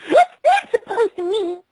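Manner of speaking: put-on high, indignant girl; high pony voice. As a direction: squeak it out, offended. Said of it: "he questioned her host".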